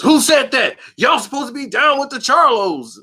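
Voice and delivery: indignant demand. Retold or imitated. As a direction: call them out for disloyalty. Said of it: "So they was".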